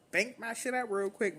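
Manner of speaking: ordinary delivery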